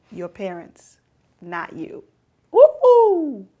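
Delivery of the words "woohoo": excited whoop. Real, not acted. Preacher whoops mid-sermon.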